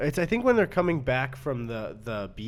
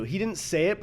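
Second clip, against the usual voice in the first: ordinary delivery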